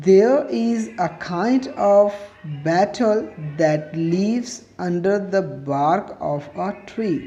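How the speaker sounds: slow, word by word, for students to copy down; slow dictation. Acted. Teacher dictating a definition to students, slow and deliberate. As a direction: dictate it so every word can be written.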